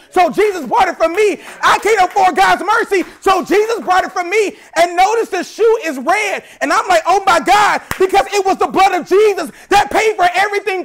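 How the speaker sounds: loud, high, building sermon cadence; preaching at full force. Real, not acted. Pastor driving home grace to congregation, full preaching.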